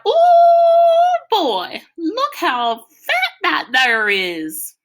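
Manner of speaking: high, loud holler at a fat squirrel. A narrator's reading. Boy calling out to Kenny about a squirrel, loud high holler. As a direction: call it out high and loud, stretched slow. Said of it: "hollered out".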